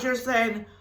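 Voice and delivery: ordinary delivery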